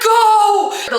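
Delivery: high, loud urgent command; mock frantic shout. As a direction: yell at a driver to floor it. Yelling at Uber driver.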